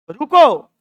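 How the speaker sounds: loud, punched "stop" order; halting command. Acted. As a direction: call out a sudden halt. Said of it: "इसको क्या बोलेगा?". (ज़ोर से रोकने का आदेश)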